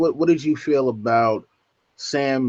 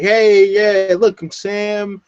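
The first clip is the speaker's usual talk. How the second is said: eager Sam; 5 semitones higher, 3 dB louder than usual. Retold or imitated. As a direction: announce yourself hopefully, a bit nervous. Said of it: "he's like".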